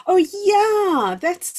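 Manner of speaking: sudden high, loud burst of delight; thrilled exclamation; excited. Real, not acted. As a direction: yelp with joy at a lovely idea. Delighted by a viewer comment.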